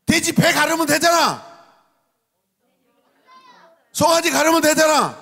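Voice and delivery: high, loud scolding shout; exasperated parent. Acted. Parent tells child to open the piggy bank, exasperated yell. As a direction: yell it as if it's obvious.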